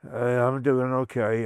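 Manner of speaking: slow, soft, worn-out answer; weak post-surgery reply. Retold or imitated. Wounded patient answering his ward mates, slow, soft and weak. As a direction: sound drained and hurting.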